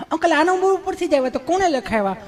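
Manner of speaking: mock insistent delivery girl; insistent young woman. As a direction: press the uncle politely but firmly.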